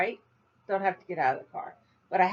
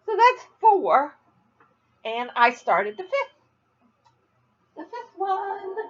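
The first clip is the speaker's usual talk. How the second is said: laughing; 10 semitones higher, 3 dB louder, slower than usual. Real, not acted. Laughing while counting.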